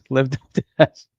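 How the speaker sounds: amused echo through a laugh. Real, not acted. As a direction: say it slowly, still laughing. Amused echo of a read comment.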